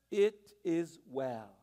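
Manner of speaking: slow, low, calm assurance. Retold or imitated. Mother reassures her husband, slow low calm voice. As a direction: settle it gently, very slowly.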